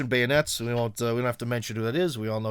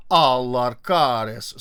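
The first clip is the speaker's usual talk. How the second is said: slow, drawn-out name in an Estonian Finnish accent, louder; 2 semitones lower, 5 dB louder, much slower than usual. Shown as a demonstration. Vlogger savoring the president's name in an Estonian Finnish accent. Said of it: "I love a good Esto-, Estonian Finnish accent".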